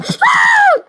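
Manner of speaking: piercing very high scream; real panicked shriek. Real, not acted. Killer attacks in game.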